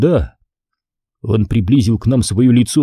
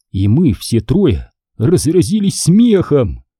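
usual speaking voice

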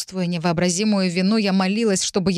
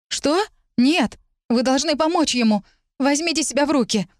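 desperate high shout; 7 semitones higher than usual. Acted. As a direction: yell at her to pull herself together. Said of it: "Я кричала".

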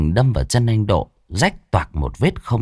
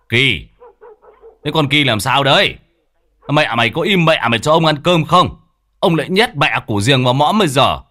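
scolding the dog; 6 semitones higher than usual. Acted. Old man yells at his barking dog, scolding. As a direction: bark it at the dog.

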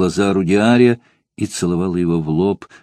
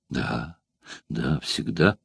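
trembling with emotion; 4 semitones lower, 9 dB quieter, much slower than usual. A narrator's reading. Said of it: "произнёс он дрожащим от волнения голосом".

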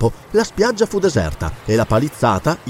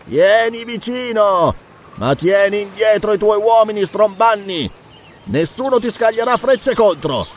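high, commanding shout; raised-pitch, measured order; authoritative call; 8 semitones higher than usual. A narrator's reading. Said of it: "una sfumatura di autorità nella sua voce".

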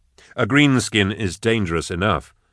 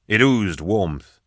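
plain reading voice

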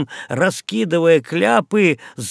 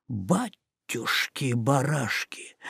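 ordinary delivery

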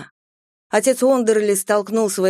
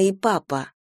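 ordinary delivery, nothing marked

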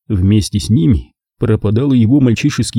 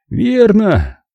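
character reply; 11 semitones higher than usual. A narrator's reading. Courtney answers in character voice.

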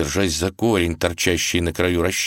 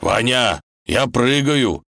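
raised, urgent call; announcing; 6 semitones higher, 4 dB louder, slower than usual. Acted. Wolf announces his jump to hanging Ivan, calling out. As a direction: call it out with nervous resolve. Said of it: "снова сообщил волк".

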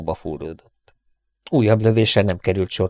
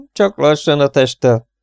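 higher and louder, taunting; mocking; 7 semitones higher, 5 dB louder than usual. A narrator's reading. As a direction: sneer at the trapped man. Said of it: "gúnyolódott Yale".

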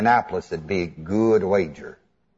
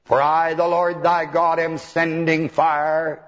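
higher, drawn-out oracle voice on a level pitch; prophesying; 4 semitones higher, slower than usual. Acted. Preacher imitating Baal's prophets prophesying, mock oracle intoning. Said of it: "I know what they prophesied".

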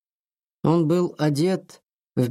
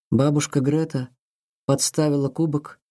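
ordinary delivery

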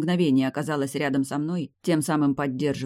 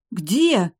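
high, loud, startled question; gasped question; 6 semitones higher, 6 dB louder than usual. A narrator's reading. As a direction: blurt it out in alarm. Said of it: "ахнула Демия".

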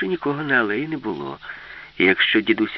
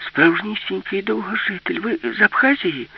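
put-on high, louder girl's voice; bright-eyed high-pitched question; excited little girl; 9 semitones higher, 4 dB louder than usual. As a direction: ask in childlike amazement.

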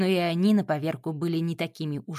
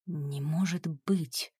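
softer, slow whispered disbelief; 3 semitones lower, 6 dB quieter, slower than usual. A narrator's reading. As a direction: breathe it to yourself, slowly. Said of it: "прошептал Макс".

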